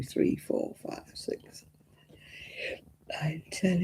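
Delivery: counting in a whisper. Real, not acted. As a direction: breathe the numbers out. Counting under her breath.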